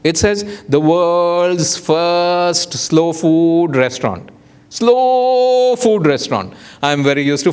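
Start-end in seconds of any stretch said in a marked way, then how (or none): 0.7-4.3 very slow, stretched words; drawn-out banner reading. Retold or imitated. Speaker reading a restaurant banner aloud, slow and drawn out. 4.7-6.5 SLOW held long on a high pitch; drawn-out SLOW. Retold or imitated. Diner marvelling at a banner, SLOW hugely stretched.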